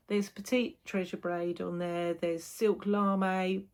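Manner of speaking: normal talking voice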